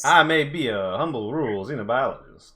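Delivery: a touch of a rural Southern reverend; lightly drawled preacher voice. Acted. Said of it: "Your, like, Southern Reverend voice".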